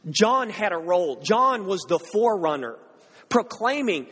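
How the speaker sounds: normal talking voice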